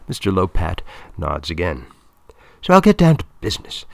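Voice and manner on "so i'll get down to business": high, loud and fast, businesslike; raised voice. A narrator's reading. Film woman turning from confidences to business, voice raised high. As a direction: lift the voice out of confidence into brisk business. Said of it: "raising her voice to its normal level".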